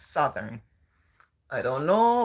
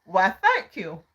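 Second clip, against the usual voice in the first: proud; 4 semitones higher, 6 dB louder, slower than usual. Retold or imitated. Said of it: "feeling very proud and so on".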